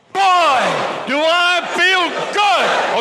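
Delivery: slow, drawn-out joyful shout; exuberant shout. Real, not acted. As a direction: belt it out like you feel great. Pumping up the audience.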